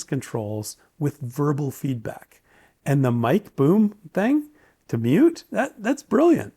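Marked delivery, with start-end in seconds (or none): 2.8-6.5 delighted. Real, not acted. Enthusing about mute boom.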